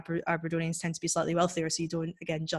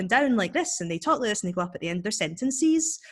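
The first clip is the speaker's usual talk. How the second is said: sing-song Glasgow and Cumbernauld lilt; demonstrating a working-class Glaswegian lilt; Glaswegian accent; 3 semitones higher than usual. Shown as a demonstration. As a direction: swoop up and down in broad Glaswegian, lifting the sentence endings. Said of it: "Glaswegian and Cumbernauld accents".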